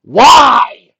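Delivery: shouted high "why"; loud high shout. Acted. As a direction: blurt it out at full volume. Sudden shouted question.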